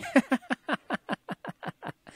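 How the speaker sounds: breathy high giggle; light teasing laugh. Acted. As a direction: laugh at his disguise. Laughing at Terry's disguise.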